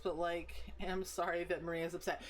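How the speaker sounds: ordinary talk, nothing marked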